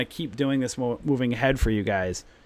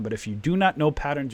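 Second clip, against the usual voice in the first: usual speaking voice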